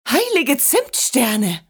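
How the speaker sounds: drawn-out cry of alarm; startled exclamation. A narrator's reading. Grandmother suddenly remembers the train, exclaiming startled. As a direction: call it out slowly in shock. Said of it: "rief sie".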